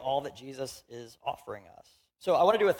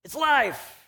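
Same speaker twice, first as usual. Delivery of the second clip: much higher, bright falsely delighted showing-off; mock cheerful denial; 7 semitones higher, 4 dB louder than usual. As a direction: chirp it like selling a dead thing as lovely. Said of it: "and we go".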